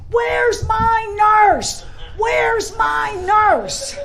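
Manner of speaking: loud, slow, drawn-out calling down the hall; a frantic patient calls out for his nurse, shouting; yelling for his nurse. Retold or imitated. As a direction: bellow it like a big patient searching the ward. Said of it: "as he's yelling".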